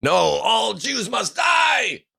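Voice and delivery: high, drawn-out mock battle cry; mock fanatical shout. Mock hardline reply to the appeal.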